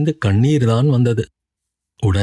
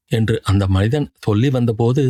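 ordinary delivery

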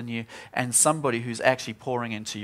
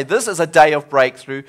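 declaring; 7 dB louder than usual. Retold or imitated. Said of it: "I start declaring".

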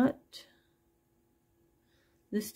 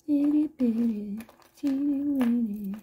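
higher, louder cutesy rhyming; playful singsong; 4 semitones higher, 4 dB louder than usual. Real, not acted. Seller teasing buyers with tiny fingers, playful singsong.

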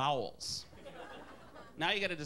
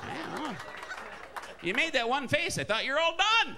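put-on high-pitched Wisconsin accent, growing louder; mock Wisconsin lover, rising to a loud finish; 11 semitones higher, 3 dB louder than usual. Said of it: "with a Wisconsin accent".

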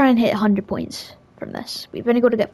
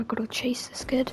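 whispering; 7 dB quieter than usual. Real, not acted. Stalking an enemy in game.

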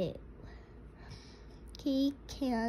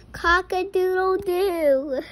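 higher, louder rooster call; rooster crow; 6 semitones higher, 8 dB louder than usual. Shown as a demonstration. Parent reading to a child, loud high rooster crow. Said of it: "He can sound like a rooster".

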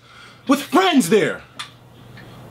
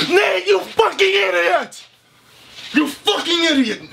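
higher, slow furious yell; angry yell; 7 semitones higher, slower than usual. Real, not acted. Yelling at Nate for his excuse, furious. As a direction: hurl it at him.

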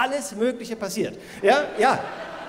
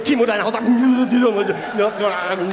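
drawn-out teacher reminder; slow, pointed schoolmaster tone; slow mock teacher; 2 semitones lower, 5 dB louder, much slower than usual. Teacher addressing pupil.

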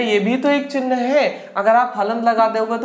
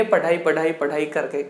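mock Bihari accent; 5 semitones lower, 3 dB quieter than usual. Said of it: "Bihari बाबू हो जाएंगे".

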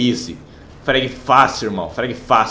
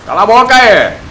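shouting; 8 semitones higher, 7 dB louder than usual. Real, not acted. Gamer yelling at enemies.

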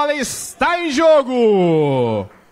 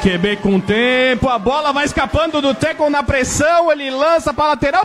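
ordinary talk

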